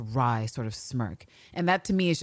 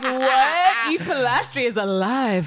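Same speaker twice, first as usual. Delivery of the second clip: stunned; 9 semitones higher, 5 dB louder, slower than usual. Retold or imitated. Villanelle hears Eve lives.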